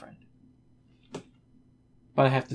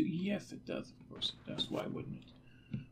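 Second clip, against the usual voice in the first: muttered sarcasm; 4 semitones higher, 15 dB quieter than usual. Real, not acted. Muttering at the game.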